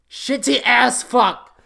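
loud, high and drawn out; loud slow delivery. Acted. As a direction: punch each word slowly. Loud slow title read.

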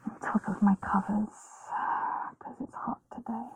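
erotic ASMR whisper. Acted.

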